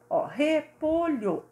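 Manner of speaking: slow demo of repolho; syllables drawn out. Shown as a demonstration. Teacher modelling a word for pupils, slow and drawn out. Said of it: "O re".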